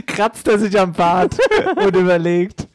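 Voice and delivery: laughing through the words. Real, not acted. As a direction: giggle while you say it. Laughing while describing partner.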